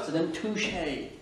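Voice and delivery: French word said with a slow English accent. Shown as a demonstration. Teacher demonstrating to French learners, slow English accent. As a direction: toucher drawn out the English way, like an anglophone. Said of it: "il faut les dire avec un accent English".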